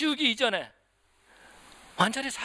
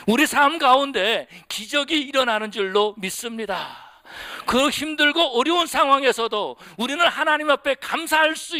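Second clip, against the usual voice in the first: louder, lifted proclamation of faith; fervent preaching; 3 semitones higher, 4 dB louder than usual. Acted. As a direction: proclaim the miracle with conviction. Preacher's climactic exhortation. (확신에 찬 힘있는 설교)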